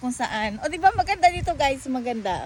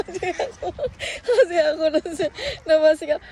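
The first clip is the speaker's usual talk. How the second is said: laughing; 6 semitones higher than usual. Real, not acted. Laughing after a fright.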